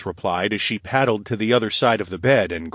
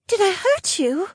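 high, anxious question; fearful and small; worried young woman. Acted. Linnea fearful.

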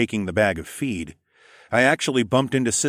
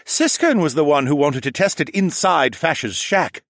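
loud, higher-pitched protest; defensive falcon man; 7 semitones higher, 5 dB louder than usual. Acted. Falcon man blaming Siskern for the test, high and loud. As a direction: shift the blame loudly.

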